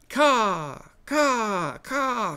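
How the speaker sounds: high cawing bird calls, rising each time; raven caws. Acted. Raven calling down at Mike, high cawing. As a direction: caw like a nagging raven. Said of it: "called out the raven".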